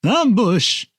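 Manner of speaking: loud, high, hammed-up Dudley Do-Right voice; James B. Sikking. Co-host shows off an impersonation of the actor, hammed-up high cry. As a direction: shout it like a cartoonish heroic soldier. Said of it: "you do a good impersonation of him".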